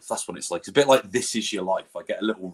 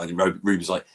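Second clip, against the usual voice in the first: normal talking voice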